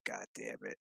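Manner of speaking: whispered, under-the-breath curse; muttered curse. Retold or imitated. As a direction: hiss it to yourself in annoyance. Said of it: "He's like".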